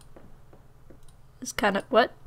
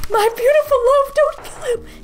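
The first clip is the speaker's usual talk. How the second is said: pleading lover; 14 semitones higher, 4 dB louder than usual. Acted. Voicing a pleading character.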